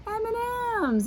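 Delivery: leaps way up in pitch on the answer; excited reveal. Acted. Teacher showing kids the candy egg, high excited reveal. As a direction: announce it like a delighted surprise.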